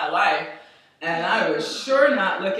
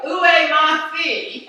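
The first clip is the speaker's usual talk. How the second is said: high, louder mock American French; bad French; 7 semitones higher, 6 dB louder than usual. Mother asking strangers for her daughter, bad French. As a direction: ask in clumsy tourist French. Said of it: "Mom's French was like".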